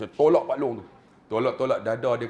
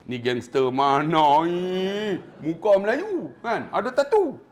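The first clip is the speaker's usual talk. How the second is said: drawn-out, rising disbelief at a tattooed stranger; mock astonishment; 3 semitones higher, 4 dB louder, much slower than usual. Onlookers spotting a tattooed gangster among pilgrims, mock astonished. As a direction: stretch it out, louder and higher, as gawking onlookers.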